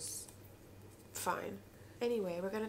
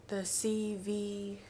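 ordinary delivery

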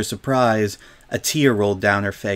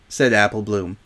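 plain reading voice

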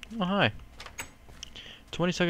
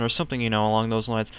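ordinary talk

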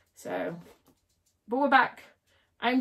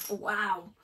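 ordinary talk, nothing marked

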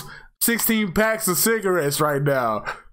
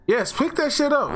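pitched way up, hyped; excited; 9 semitones higher than usual. Real, not acted. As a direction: cheer yourself on. Excited at finding key.

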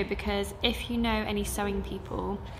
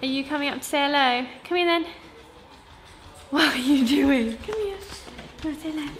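high, louder coaxing of a pet; coaxing pet talk; 4 semitones higher, 5 dB louder than usual. Real, not acted. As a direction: call the little one over in a raised pet voice. Calling pet over to greet viewers.

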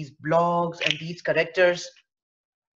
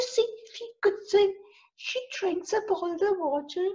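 very high squeaky frog rhyme; tiny frog voice; 14 semitones higher than usual. Shown as a demonstration. Teacher doing the tiny frog rhyme, very high. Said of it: "a voice of a tiny creature".

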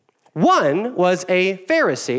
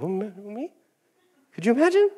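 mock offended tax collector; 5 semitones higher than usual. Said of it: "Tax collector's like".

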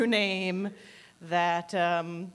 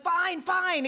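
higher-pitched, fed-up giving in; mock exasperation; exasperated surrender; 8 semitones higher than usual. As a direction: act out caving under nagging. Giving in to pushy questioners.

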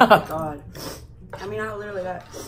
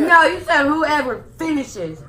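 indignant; 8 semitones higher, 11 dB louder, slower than usual. Real, not acted. Heated argument over the rules.

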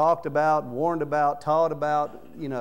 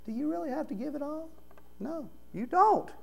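mock tempter, teasing him into it; Satan tempting; 10 semitones higher, 7 dB quieter than usual. Satan coaxing Ananias to hold back, high and sly. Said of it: "Satan filling Ananias' heart".

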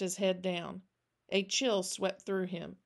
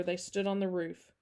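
usual speaking voice

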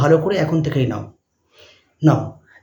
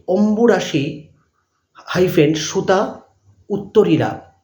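dictating; much slower than usual. Shown as a demonstration. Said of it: "inverted কমা দিয়ে".

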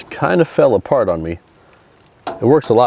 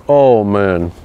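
usual speaking voice

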